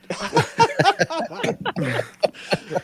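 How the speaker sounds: long, high-pitched laugh; drawn-out real laughing fit; burst of laughter. Real, not acted. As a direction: laugh hard and high at the joke. Laughs at joke about counting.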